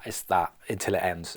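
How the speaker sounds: ordinary talk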